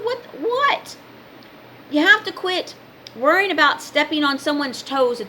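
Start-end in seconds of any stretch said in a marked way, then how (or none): 0.0-1.0 high-pitched retold "what"; baffled disbelief. Retelling her reply to the objectors, baffled disbelief. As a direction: throw it back high, as a puzzled question.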